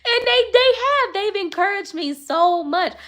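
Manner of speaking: high-pitched, welling up with gratitude; emotional. Real, not acted. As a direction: gush it high, overcome by their encouragement. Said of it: "I'm gonna get emotional".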